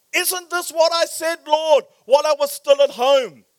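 high, loud indignant protest to the Lord; angry prayer. Acted. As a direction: complain loudly up at God. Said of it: "and he became angry".

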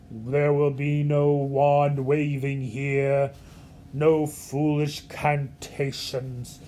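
creepy, slow Dracula-esque voice; low, drawn-out imitation of a dark, sinister professor; Snape. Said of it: "creepy old, um, Dracula-esque voice".

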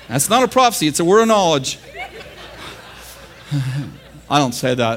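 ordinary talk

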